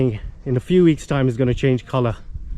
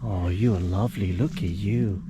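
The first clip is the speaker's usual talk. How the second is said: put-on talking to a baby; 7 semitones lower, 6 dB quieter than usual. Cooing at a baby animal.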